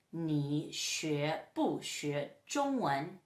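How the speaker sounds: very slow, low Mandarin example; slow model question. Shown as a demonstration. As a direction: space out each syllable for learners. Teacher models a Chinese example question.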